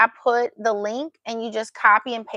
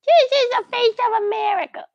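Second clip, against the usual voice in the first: This is the face of America in a much higher, louder cooing squeal; 12 semitones higher, 6 dB louder than usual. Shown as a demonstration. Mom squealing over her baby's face for viewers. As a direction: squeal it up high like showing off the baby.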